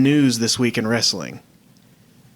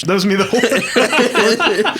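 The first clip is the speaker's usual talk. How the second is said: laughing; 10 semitones higher, slower than usual. Real, not acted. Laughing at own story.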